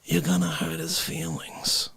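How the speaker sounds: soft, hurt-sounding character voice; Dick Facce. Acted. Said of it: "Facce actually says this".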